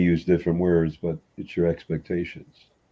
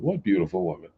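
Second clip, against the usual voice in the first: mock innocent husband, softer; 3 dB quieter, faster than usual. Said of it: "You go".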